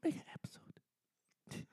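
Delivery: soft unvoiced whisper. Shown as a demonstration. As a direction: whisper the name like a secret. Repeating the joke name.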